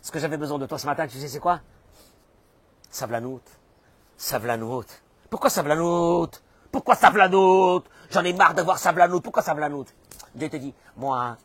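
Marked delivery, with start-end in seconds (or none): 5.3-9.9 indignant protest. Acted. Acts out protest against patience.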